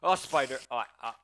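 loud surprised yelp; startled shout. Real, not acted. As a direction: jump and shout at the spider. Startled by spider.

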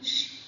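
"ش" as a bare voiceless shh; isolated sheen sound. Shown as a demonstration. Teacher answering her own question to pupils, "ش" as pure shh. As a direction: sound only the consonant "ش", shh with no vowel. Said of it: "صوت الشين إيه؟".

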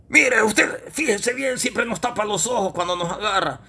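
high, put-out comic puppet voice; touchy high-pitched little character; complaining puppet character. Acted. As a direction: grumble at the handler in a high voice. Puppet Deca complains to host.

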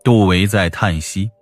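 plain reading voice

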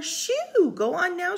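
high, loud shoo to chase him off; shooing away. Acted. Car owner shooing the wet dog, high and loud.

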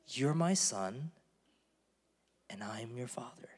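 a father's tender whispered promise. Retold or imitated. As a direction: hush to a whisper as you assure your child. Father tenderly assuring son.

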